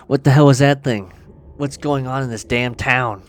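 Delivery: loud, shaken cry of disbelief; shocked outburst. Acted. Eve, shaken after the kill, loud shocked outburst. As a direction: blurt it out, rattled by the monster. Reading Eve's shocked line.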